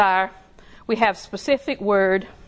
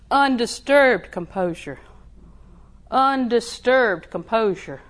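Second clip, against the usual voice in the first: drawn-out, deliberate declaration; slow each word down like a mantra; slow, steady confession of peace; slow repeated affirmation; 2 semitones higher, slower than usual. Shown as a demonstration. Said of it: "I'm going around saying".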